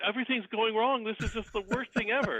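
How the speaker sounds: mock panic, voice pushed up high; freaking out. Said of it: "my wife is, like, freaking out".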